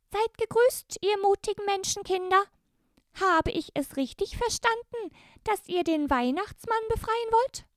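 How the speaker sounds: very high, squeaky elf voice; Christmas elf. Acted. The elf Sternenlicht greets the brave girls, very high elfin voice. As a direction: greet them as a tiny, courtly elf.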